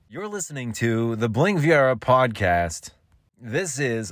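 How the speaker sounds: high, swooping podcast ident; announcer inflection. Shown as a demonstration. Podcaster rehearsing his segment reminder, showy high announcer inflection. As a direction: sweep up high on "listening" and "Bling Viera". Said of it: "You put some different inflection".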